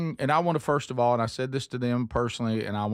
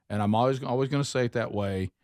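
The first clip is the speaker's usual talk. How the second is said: ordinary talk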